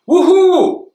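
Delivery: high, loud whooping cheer. Acted. The garden gang whoops as the skis are reached. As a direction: whoop it out in triumph. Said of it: "The garden gang cheered".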